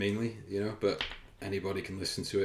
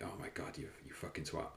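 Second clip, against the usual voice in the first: whispering; 3 semitones lower, 10 dB quieter than usual. Retold or imitated. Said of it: "I'm just gonna be like".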